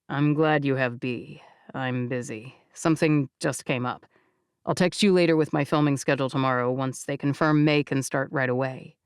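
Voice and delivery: put-on distant boyfriend cutting it short; Jake's voice.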